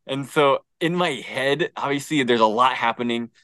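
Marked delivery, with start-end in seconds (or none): none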